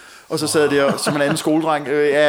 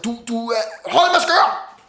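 much higher mock yell from a colleague; retold shout; 5 semitones higher, slower than usual. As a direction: blurt it out in a high outburst. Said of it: "røg bare ud og sagde".

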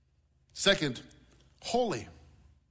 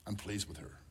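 much softer, gentle Father voice; tender Father's voice; 9 semitones lower, 12 dB quieter than usual. Pretend. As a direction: speak it softly like a loving father's blessing. Voice of the Father spoken over.

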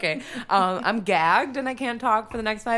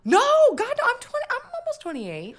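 startled protest; 5 dB louder than usual. Real, not acted. Protesting her age guess.

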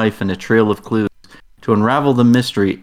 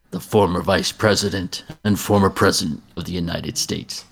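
movie trailer voice; 2 semitones lower than usual. Acted. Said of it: "I can see the movie trailer for this already".

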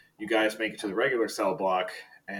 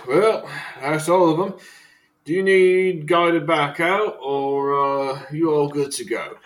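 loud, higher, drawn-out guard voice; prison guard; 6 semitones higher, 7 dB louder, slower than usual. Acted. Guard offering to guide visitors back out, loud and slow. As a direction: raise the voice like a guard seeing visitors off.

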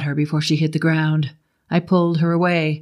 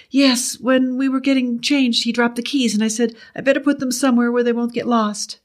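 lightly voiced woman, higher; higher-pitched woman, explaining; Betty's voice; 8 semitones higher than usual. A narrator's reading. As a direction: explain in a raised feminine voice.

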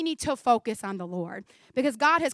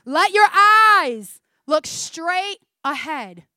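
very slow and louder, proclaimed; slow emphatic reading; 3 semitones higher, 9 dB louder, much slower than usual. Shown as a demonstration. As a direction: proclaim each word slowly to the room. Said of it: "I'm gonna read it slowly so it sinks in".

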